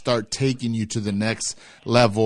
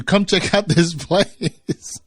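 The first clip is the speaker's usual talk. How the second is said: laughing; 6 semitones higher, slower than usual. Retold or imitated. Host quotes brother, laughing.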